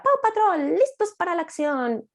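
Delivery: high cartoon-style shout of a catchphrase; mock yell. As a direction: belt the slogan like an excited kid. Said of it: "el peque grita".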